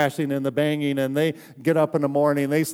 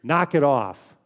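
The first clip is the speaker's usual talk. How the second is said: firm order, a touch louder; firm command; 4 dB louder, slower than usual. Retold or imitated. Jesus to the wind, a firm command. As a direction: echo a parent's order, toned down from a yell. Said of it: "Jesus says to the wind".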